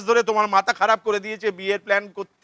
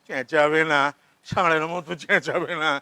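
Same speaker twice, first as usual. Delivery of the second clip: normal talking voice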